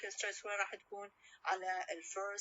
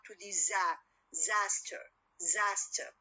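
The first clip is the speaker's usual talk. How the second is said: zaster said louder with the stress on za; 4 dB louder, slower than usual. Shown as a demonstration. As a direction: punch za in zaster. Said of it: "stress when will go to what".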